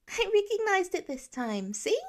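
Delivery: put-on very high, excited child voice; little boy showing off to the teen; proud little boy. A narrator's reading. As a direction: show off a new word. Child Nate voice.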